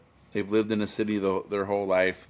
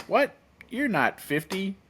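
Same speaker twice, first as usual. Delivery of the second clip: much higher, slower hammed-up disbelief; mock disbelief; 12 semitones higher than usual. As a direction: playfully act out people's shock at his age. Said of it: "They're like".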